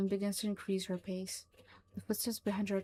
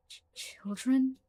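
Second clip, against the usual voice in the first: stunned; 5 semitones higher, 4 dB louder, slower than usual. Acted. Mullins stunned by revelation.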